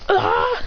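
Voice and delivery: high startled yell; acted high scream; cry when thrown. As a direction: yelp as you go flying. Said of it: "And throws him. He goes".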